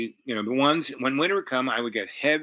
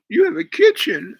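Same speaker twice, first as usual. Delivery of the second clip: high, loud imitation of a crying woman; mock crying; 10 dB louder than usual. Crying woman on discovering his kitchen, mock tearful. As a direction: wail it tearfully, far above your own voice. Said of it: "I hear her crying".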